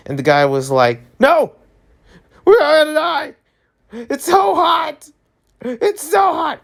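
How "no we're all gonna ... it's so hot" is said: put-on very high, wailing British accent. Scared man on UK news wailing about heat, mock British accent. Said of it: "in a British accent".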